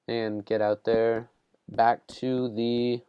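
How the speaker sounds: usual speaking voice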